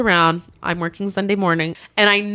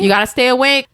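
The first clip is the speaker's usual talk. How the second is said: raised, louder voice to wake someone; retold rousing call; 6 semitones higher, 5 dB louder than usual. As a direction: imitate a medic rousing a patient. Paramedics trying to wake him.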